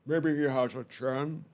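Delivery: put-on slow, slurred, laughing drunk; hammed-up tipsy slur. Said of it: "I sound like a drunk".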